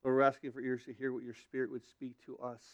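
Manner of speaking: normal talking voice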